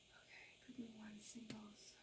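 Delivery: whispered repeat of the group name. Shown as a demonstration. Tarot reader repeating the group for viewers, whispered. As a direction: breathe it to the camera as a secret.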